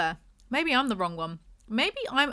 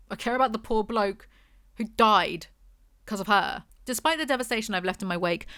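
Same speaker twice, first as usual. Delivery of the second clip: ordinary talk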